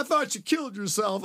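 high, amused retold quote; imitating a friend laughing at him; laughing sponsor. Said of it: "and he's laughing".

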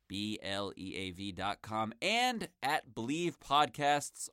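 each letter of "B L E A V" set apart, unhurried; "B L E A V" spaced out letter by letter, slow; slow spelled address. Shown as a demonstration. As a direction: spell "B L E A V dot com" slowly and carefully. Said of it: "That's B L E A V dot com".

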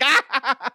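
high laughing yelp of panic; nervous yelp. Retold or imitated. Nervous lifter yelping at Arnold, laughing. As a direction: squeal like a startled lifter. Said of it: "the guy's like".

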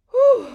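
high loud exhale of relief; loud whew. Real, not acted. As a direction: blow out the breath hard. Catching breath after laughing.

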